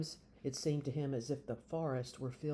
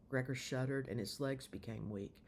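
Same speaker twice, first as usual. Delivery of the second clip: ordinary delivery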